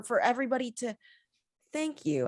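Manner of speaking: ordinary delivery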